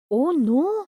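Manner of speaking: mock fright. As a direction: fake being scared, teasingly. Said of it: "Sayali घाबरल्याच्या आविर्भावात उद्गारली". (खोटं घाबरल्याचा आव आणत)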